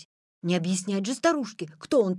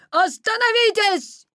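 high, loud, drawn-out command; shouting; 12 semitones higher, 7 dB louder, slower than usual. A narrator's reading. Petya chasing the Wolf from behind, long high shout. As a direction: holler after the runaway. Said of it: "закричал Петя".

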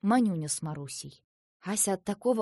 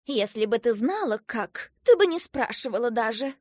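louder, exasperated scolding; cross; 3 semitones higher, 6 dB louder than usual. A narrator's reading. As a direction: scold the child with mild anger. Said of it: "рассердилась сдобная булочка".

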